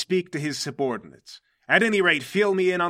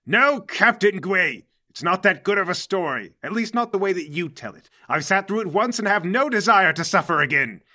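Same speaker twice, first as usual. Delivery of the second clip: loud, higher, theatrical protest; exasperated interruption; 5 semitones higher, 7 dB louder than usual. Acted. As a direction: cut him off like an exasperated boss. Mercury cuts off Gwei's story.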